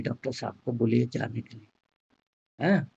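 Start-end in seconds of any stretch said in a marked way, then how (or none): 0.0-1.7 low, soft ashamed request; softly spoken. Retold or imitated. Patient quietly asks her father to send the doctor away, soft. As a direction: murmur it gently to her father. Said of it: "धीरे से बोले".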